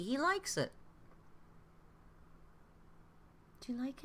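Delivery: thrilled he likes the gift; gushing to the cat and the viewers; delighted pet talk. Real, not acted.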